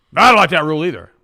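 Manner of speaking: playfully acted loud refusal; indignant refusal. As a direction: bark back like a stubborn kid. Retold chat, refusing each rule.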